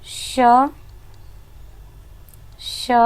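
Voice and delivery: a long breathy hiss on श with no voice; hissed sh sound. Shown as a demonstration. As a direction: let श hiss out like escaping warm air. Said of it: "ऊष्म ध्वनि आपकी होती है".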